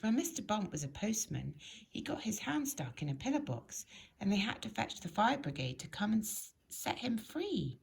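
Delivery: ordinary delivery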